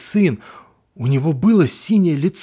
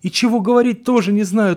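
ordinary delivery, nothing marked

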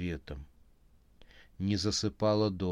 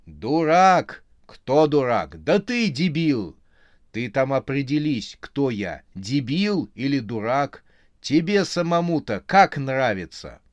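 loud, higher angry yelling; shouting match; 3 semitones higher, 7 dB louder than usual. A narrator's reading. Lovers shouting insults at each other. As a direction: hurl the insults. Quarrel escalating.

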